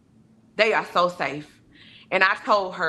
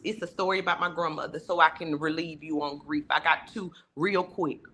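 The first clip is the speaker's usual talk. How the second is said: ordinary delivery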